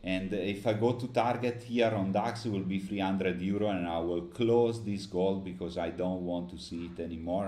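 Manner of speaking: usual speaking voice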